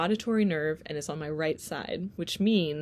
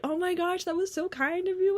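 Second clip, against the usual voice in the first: gushing audiologist; 12 semitones higher than usual. Retold or imitated. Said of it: "they're like".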